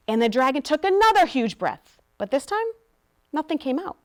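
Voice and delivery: usual speaking voice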